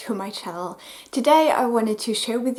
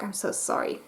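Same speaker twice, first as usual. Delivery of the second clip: whispered apology; 9 dB quieter than usual. Real, not acted. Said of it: "I can't talk".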